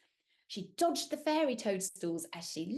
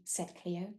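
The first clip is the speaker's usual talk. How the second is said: ordinary delivery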